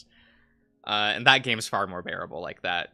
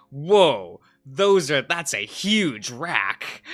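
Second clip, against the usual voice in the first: louder, slow leering exclamation, lightly voiced; mock Eggman; 3 semitones higher, 6 dB louder, much slower than usual. Streamer retelling Eggman gawking at Rouge, mock leer. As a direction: ham up a gawking villain. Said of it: "Eggman's reaction is like".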